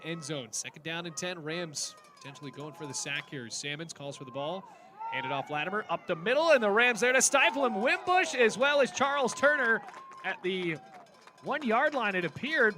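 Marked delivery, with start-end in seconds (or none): 5.9-12.8 loud rising play-by-play burst; excited call. Real, not acted. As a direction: build it as the defense swarms. Goal-line stop called live.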